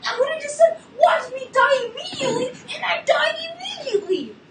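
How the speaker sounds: high, loud shriek of laughter through the words; real giddy laughter; laughing in disbelief. Real, not acted. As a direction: crack up at your own bad luck. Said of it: "I'm laughing".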